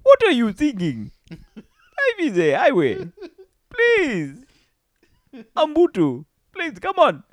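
high, loud hammed-up Indian accent; laughing mock Indian accent. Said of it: "Still Indian".

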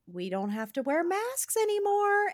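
high, thrilled teacher voice; excited announcement. Retold or imitated. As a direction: reveal the big news to the kids. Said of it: "And she goes".

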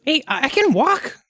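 high-pitched little kobold. Acted. Kobold protesting being carried, high kobold voice. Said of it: "The kobold says".

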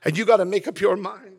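normal talking voice

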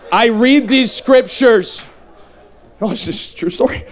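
ordinary talk, nothing marked

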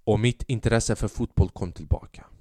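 ordinary talk

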